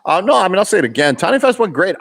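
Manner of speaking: ordinary delivery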